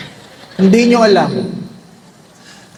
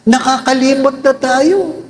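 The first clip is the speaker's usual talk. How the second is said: usual speaking voice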